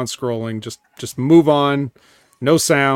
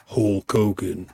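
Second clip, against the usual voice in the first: slow, hoarse "Hulk Hogan"; raspy slow drawl; 6 semitones lower, 4 dB quieter, slower than usual. Retold or imitated. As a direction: growl "Hulk Hogan" slowly. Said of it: "so he goes".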